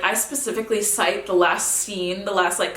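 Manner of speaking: usual speaking voice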